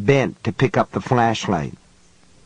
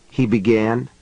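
ordinary delivery, nothing marked

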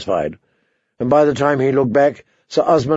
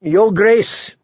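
raised, high address with rising end; ringing, pitched-up call; 6 semitones higher than usual. A narrator's reading. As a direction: hail the king across the hall. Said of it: "he called".

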